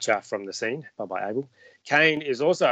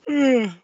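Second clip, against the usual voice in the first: big hammed-up yawning sigh; a high, loud mock-drowsy sigh; exaggerated sleepy sigh; 11 semitones higher, 7 dB louder than usual. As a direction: sigh like you can't stay awake. Mock sleepiness after bedtime poems.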